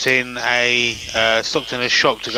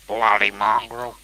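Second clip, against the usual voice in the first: slow attempt at an Aussie accent; mock-Australian drawl; imitated Australian accent; slower than usual. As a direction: echo it in a put-on Australian accent. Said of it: "trying to do our Australian accent".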